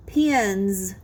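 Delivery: low "pins" with a buzzing, held ending; final Z sound. Shown as a demonstration. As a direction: stretch the z at the end of "pins". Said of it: "the Z sound like pins".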